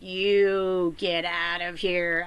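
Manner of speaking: very slow drawn-out get out; Kristen Wiig bit. Retold or imitated. Throwing out her partner, slow Kristen Wiig sketch voice. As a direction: stretch every word like the sketch. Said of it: "skit with Kristen Wiig".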